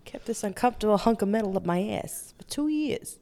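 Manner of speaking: halting celebrity impression, softened; odd cadence with a pause near the end; Christopher Walken. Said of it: "do it as Christopher Walken".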